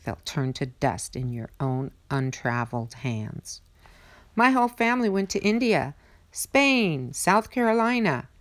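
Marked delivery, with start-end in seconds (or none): 4.3-8.3 high, fast eager listing; excited classmate. A narrator's reading. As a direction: rattle off the places proudly. Classmate boasting of travels.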